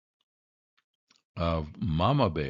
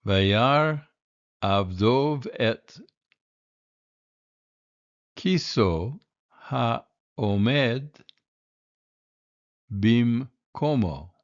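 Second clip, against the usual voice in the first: plain reading voice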